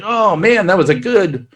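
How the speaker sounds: high, amazed waking-up sigh; high waking groan. Retold or imitated. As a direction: crew groaning awake, wrong first take. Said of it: "they're like".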